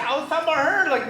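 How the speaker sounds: normal talking voice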